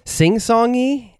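high, slow sing-song lilt; lilting high and slow, drawn out. Shown as a demonstration. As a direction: sing it out like a melody. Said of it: "Italian flavor that's sing-songy".

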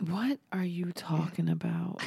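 slow, incredulous whisper; whispered disbelief. Real, not acted. Reacting to co-host's frank claim, disbelieving whisper. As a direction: whisper it as a baffled aside.

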